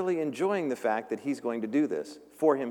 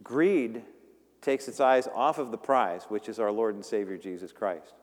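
ordinary talk, nothing marked

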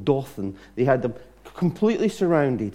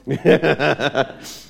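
laugh; 3 dB louder than usual. Real, not acted. Laughs at own joke.

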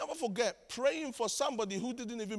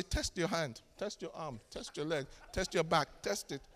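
softer, lower run of instructions; low-key instruction; 4 semitones lower, 5 dB quieter than usual. Retold or imitated. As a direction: toss off each command quietly and evenly. Lists his usual instruction after prayer.

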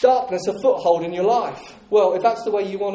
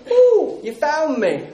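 sudden high, loud squeal of a caught thing; squealing stronghold; 7 semitones higher, 4 dB louder, slower than usual. Acted. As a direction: shriek like something just discovered. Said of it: "It screams".

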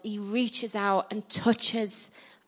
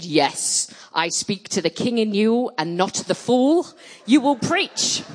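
typical Nigerian mama; 3 semitones higher, 4 dB louder than usual. Retold or imitated. Nigerian mama ordering the reluctant girl to preach, imperious. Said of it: "being a typical Nigerian mama".

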